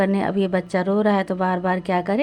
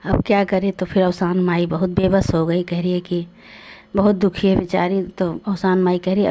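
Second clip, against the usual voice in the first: plain reading voice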